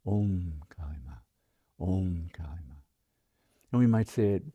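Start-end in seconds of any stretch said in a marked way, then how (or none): 0.1-1.8 slow whispered mantra, lower and softer; soft slow whispered chant of the mantra; silent repetition. Shown as a demonstration. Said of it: "now it's just a silent repetition over and over". 1.8-2.7 quiet repetition. Shown as a demonstration. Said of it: "silent repetition over and over".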